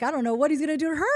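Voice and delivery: loud, high, very fast rush; retold alarm. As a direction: re-enact a mother's urgent fear for her child. Retold alarm for her daughter.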